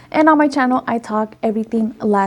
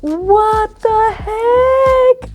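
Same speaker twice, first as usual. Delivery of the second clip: very high, long-drawn squeal; amazed; 11 semitones higher, much slower than usual. Real, not acted. Vlogger stunned by all the products, amazed. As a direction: stretch every word in delighted disbelief.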